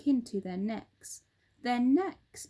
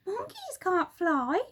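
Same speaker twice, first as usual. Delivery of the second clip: high scoffing monkey; high monkey dismissing; Mugglewump; 9 semitones higher, 3 dB louder than usual. Acted. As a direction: scoff at the silly bird.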